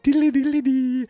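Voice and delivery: high, sing-song "diddly" jingle; imitated magic chime. Recapper voicing the moment a book falls, sing-song chime.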